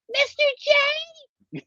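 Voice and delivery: very high squeaky cartoon girl voice; Harley Quinn voice. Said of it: "I can hear her voice in my head".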